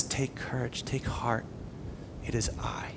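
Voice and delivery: very soft and low; solemn, low hush, letting it sink in; hushed reassurance. Retold or imitated. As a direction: comfort the worn-out in a near whisper. Voicing Jesus comforting exhausted disciples.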